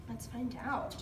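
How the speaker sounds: ordinary talk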